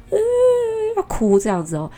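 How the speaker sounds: long "uhhh" of fake crying; imitated child's whine. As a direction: imitate a child starting to wail.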